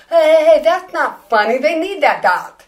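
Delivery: loud, indignant protest, last words slowed; upset outburst. Retold or imitated. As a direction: snap back loudly at a bad joke. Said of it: "I would get all upset".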